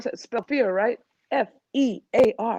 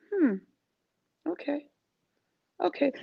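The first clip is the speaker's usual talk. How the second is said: much softer, musing okays; thoughtful hmm; 5 semitones higher, 7 dB quieter than usual. Retold or imitated. A coach quietly taking in what a client says. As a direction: murmur it while taking it in. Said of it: "it allows me to say".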